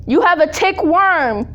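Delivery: high, loud, slow, dramatic mother; imitating mom. Impersonating her mom diagnosing her, high and slow. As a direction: announce the illness like a worried mom.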